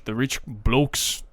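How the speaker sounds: slow mock Irish accent. Naming the rich neighbours to Connor, slow mock Irish accent. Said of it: "Tony's not an Irish name".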